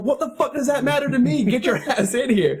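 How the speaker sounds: high, heated retort with a laugh creeping in; mock indignant demand. Driver dismisses the teens' age excuse, mock indignant. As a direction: brush off his excuse and order him over. Retold argument with teen driver.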